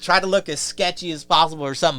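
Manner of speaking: normal talking voice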